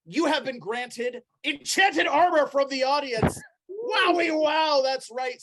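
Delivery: high, loud game-show reveal; big excited announcer voice; prize announcer. Pretend. Game master announces audience prize.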